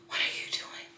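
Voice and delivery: breathless, voiceless whisper of disbelief; shocked whisper. Real, not acted. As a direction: whisper it like you can't believe them. Disbelief at reader not reading.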